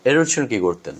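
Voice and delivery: usual speaking voice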